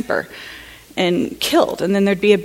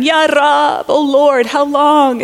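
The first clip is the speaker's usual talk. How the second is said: raised, keening lament; high, mournful plea, lightly voiced; mourning wail; 6 semitones higher, much slower than usual. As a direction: echo the grieving women's cry to God. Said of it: "the women would be weeping and crying".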